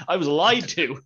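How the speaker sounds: loud outraged complaint; indignant rant. Retold or imitated. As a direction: blurt it out betrayed. Imagined cop ranting about the job.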